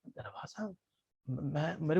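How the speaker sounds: hushed, hesitant whisper. Acted. Girl whispering her refusal to Jaimini. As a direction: breathe it, unsure.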